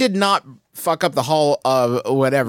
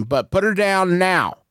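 firm demand; 6 semitones higher, slower than usual. Acted. Character demands monster free hostage.

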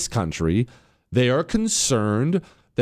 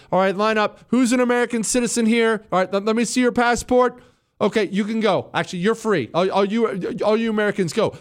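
higher-pitched mock captor giving orders; imagined hostage-taker; 9 semitones higher than usual. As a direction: play a captor hurriedly checking passports. Mimics captors sorting hostages.

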